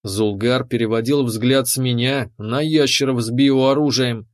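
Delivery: ordinary delivery, nothing marked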